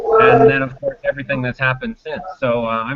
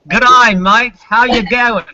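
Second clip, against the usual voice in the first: broad mock Australian, higher and louder; Australian accent; 8 semitones higher, 8 dB louder, slower than usual. Canadian economist greeting the host in mock Australian accent. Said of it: "Great, great accent".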